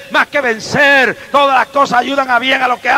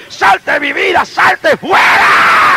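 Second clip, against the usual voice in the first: high loud drawn-out shout; shouted rebuke; 9 semitones higher, 3 dB louder, slower than usual. Real, not acted. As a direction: cast it out at full voice. Reprensión al diablo. (grito de reprensión, agudo y alargado)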